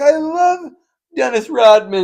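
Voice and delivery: high, loud gushing; mock starstruck judge. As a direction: swoon over the celebrity. Judge gushing over celebrity.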